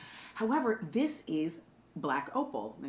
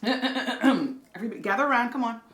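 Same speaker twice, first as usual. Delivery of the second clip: gather the crowd for a grand reading; dramatic read; 4 semitones higher, 6 dB louder than usual. Acted. Host calls the audience in for a dramatic book read. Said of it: "do my dramatic read".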